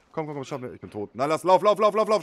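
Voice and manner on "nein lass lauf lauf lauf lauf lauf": being chased. Real, not acted. Urging teammate to flee.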